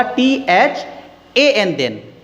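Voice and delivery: slow, deliberate spelling for the class; slow spelled-out emphasis. Shown as a demonstration. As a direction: spell टीएचएएन then very slowly, letter by letter. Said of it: "टीएचईएन वाला नहीं".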